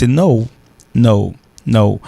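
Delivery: no said "noh-oo", repeated; English no, each one set apart; long English O. Shown as a demonstration. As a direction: stretch the vowel of no with a glide. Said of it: "mettez N".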